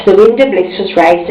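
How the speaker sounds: plain reading voice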